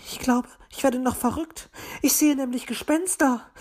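high, distraught magpie voice; high-pitched, rattled bird lady; magpie bursting out. A narrator's reading. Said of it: "brach es aus der Elster heraus".

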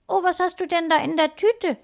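put-on high, eager child voice; high-pitched, wide-eyed curiosity; curious little sister. A narrator's reading. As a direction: ask as a nosy little girl. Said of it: "Neugierig streckte Lena".

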